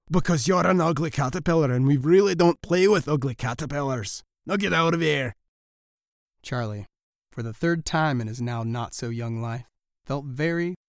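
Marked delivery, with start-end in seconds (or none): 0.1-5.4 louder mouse voice, scornful; shooing the caterpillar off; mean mice. Acted. Mice send Charlie away.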